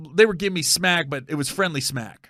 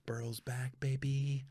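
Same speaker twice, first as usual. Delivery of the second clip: whispered hype; 2 semitones lower, 13 dB quieter, slower than usual. Real, not acted. Comic hyping his quarterback, whispered. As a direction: whisper it like a secret brag.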